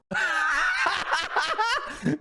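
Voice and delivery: high helpless laugh at his own joke; burst of laughter. Real, not acted. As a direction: crack up, no words. Own tagline joke cracks him up.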